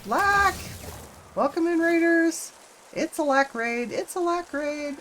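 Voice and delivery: high, loud happy welcome; excited greeting. Real, not acted. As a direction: cheer them in like a host. Greeting raiders loudly.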